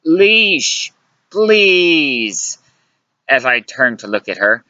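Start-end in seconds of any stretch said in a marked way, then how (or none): 0.1-2.6 raised, drawn-out exasperated plea; pleading. A narrator's reading. He begs Luciana to let it go, pleading. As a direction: beg her to stop, stretching each word.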